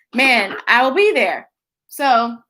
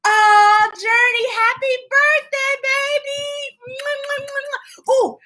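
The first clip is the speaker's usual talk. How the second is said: much higher, sing-song hellos; excited sing-song greeting; 11 semitones higher, slower than usual. Real, not acted. Host greets kids and wishes Journey happy birthday in a high sing-song.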